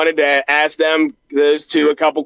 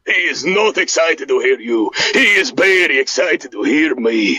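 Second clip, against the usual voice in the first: put-on deep, proud Eastern European accent; Boris voice; 3 semitones lower than usual. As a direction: play the boastful Eastern European cousin. Plays Boris character.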